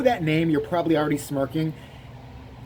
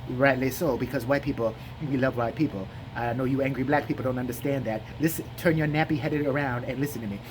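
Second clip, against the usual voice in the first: low, drowsy interviewer voice; sleepy mock-serious monologue; Jesse Lee Peterson; 3 semitones lower than usual. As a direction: drone it out, slurring and unbothered. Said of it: "he goes like this".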